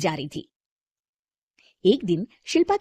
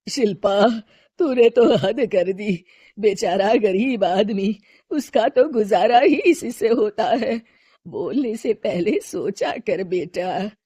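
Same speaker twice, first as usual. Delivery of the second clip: grandmother gently chiding her daughter-in-law; old mother-in-law; 6 dB louder, slower than usual. Acted.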